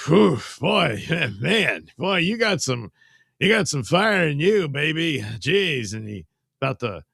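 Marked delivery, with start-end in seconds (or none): 0.1-5.9 a hint of a winded, rattled tough guy; lightly voiced shaken killer. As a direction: blow out a breath, half impressed. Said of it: "he's sitting there like".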